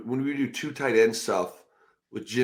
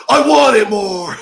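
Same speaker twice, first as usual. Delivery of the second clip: mock yell; 10 semitones higher, 14 dB louder, slower than usual. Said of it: "then look at us and go".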